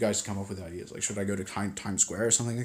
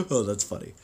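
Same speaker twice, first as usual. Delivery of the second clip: ordinary delivery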